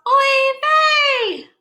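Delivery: shrill high oy vey of a worried mother; cried out oy vey. Acted. Mama Gefilte crying out for her lost children, high wail. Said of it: "Mama Gefilte cried out".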